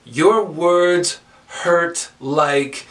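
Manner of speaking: very slow, each word spaced out; open-ended lead line. Shown as a demonstration. Teacher giving students a first line, open-ended. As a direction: draw it out and leave LIKE hanging. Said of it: "and I'll say dot, dot, dot".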